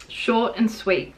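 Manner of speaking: usual speaking voice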